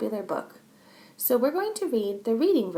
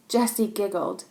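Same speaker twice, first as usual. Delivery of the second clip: plain reading voice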